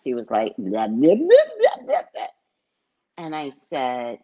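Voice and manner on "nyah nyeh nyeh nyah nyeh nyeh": garbled nonsense syllables sliding up high; muffled mask talk. Retold or imitated. Store clerk talking to a customer through a mask, muffled. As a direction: imitate an unintelligible muffled clerk. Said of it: "behind the muffle of her mask".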